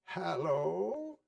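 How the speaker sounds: a hint of the old grumpy Scrooge; feigned growl. A narrator's reading. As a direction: put on his old gruff tone, half-hearted. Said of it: "growled Scrooge in his accustomed voice".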